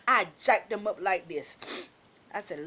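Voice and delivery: plain reading voice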